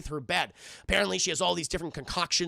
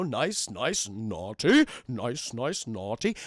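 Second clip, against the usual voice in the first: low, slow Santa murmuring over a list; deep, deliberate list-checking voice; Santa checking his list; 9 semitones lower, much slower than usual. Retold or imitated. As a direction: drop deep and draw out each word.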